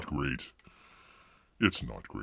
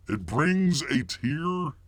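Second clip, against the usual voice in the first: ordinary talk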